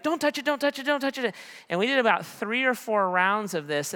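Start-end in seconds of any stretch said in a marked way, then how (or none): 0.0-1.3 high, frantic imitation of a child; panicked protest. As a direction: ward off the hand in a squeaky rush. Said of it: "I'd be like".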